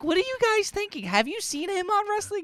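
high-pitched disbelieving outburst; incredulous rant. Retold or imitated. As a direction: throw it out in shrill disbelief. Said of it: "I'm like".

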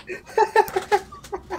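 long, high-pitched laugh; real laughing fit. Real, not acted. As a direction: crack up helplessly. Cracking up at the reaction.

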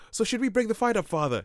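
put-on high-pitched flustered young priest; high fussy young clergyman. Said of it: "the young clergyman is like".

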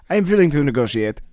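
put-on comic German accent; German-accented offer to deal. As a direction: concede as the German sailor. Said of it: "I'm from West Germany".

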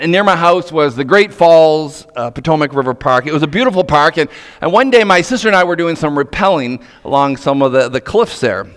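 normal talking voice

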